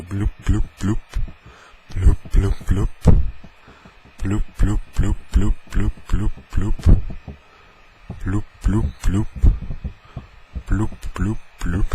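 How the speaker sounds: repeated loud bloops, each rising; bloop noise. Retold or imitated. Imitating the packaging noise for listeners, loud repeated bloops. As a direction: chant bloop in short bursts like popping plastic. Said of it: "that's the noise".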